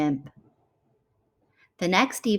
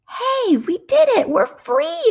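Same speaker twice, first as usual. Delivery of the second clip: much higher, louder joyful cry; excited exclaim; 7 dB louder, slower than usual. Acted. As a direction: shout it out in triumph. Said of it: "exclaimed Kang".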